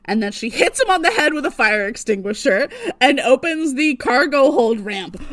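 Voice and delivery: ordinary delivery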